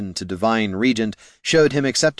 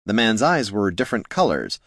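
usual speaking voice

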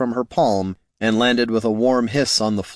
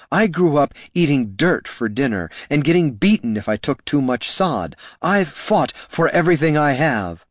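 higher, intense defiance; heated outburst; 6 semitones higher than usual. A narrator's reading. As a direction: throw her hard past at him. Laruna's angry outburst.

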